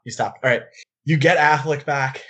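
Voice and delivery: ordinary talk, nothing marked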